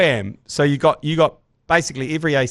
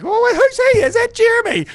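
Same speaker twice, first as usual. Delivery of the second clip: much higher, louder put-on voice; shocked parent; 5 dB louder than usual. Parent recognising Jerry at the party, high squeaky mock voice. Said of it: "And he was like".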